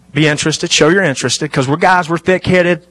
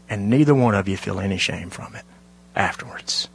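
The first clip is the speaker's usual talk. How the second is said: hushed; 8 semitones lower, 9 dB quieter than usual. Real, not acted. Preacher asking couples about shame, dropping to a hushed whisper. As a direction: drop to a near whisper, much softer.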